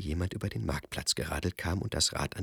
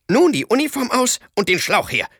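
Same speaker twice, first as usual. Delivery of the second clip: laut befehlend; 12 dB louder than usual. Acted. Kasperl gibt Befehle.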